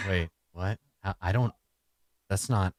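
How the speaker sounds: low, soft, halting confusion; baffled stammer. Acted. Soldier stumped by an unheard-of question, baffled. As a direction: mumble it haltingly, at a loss.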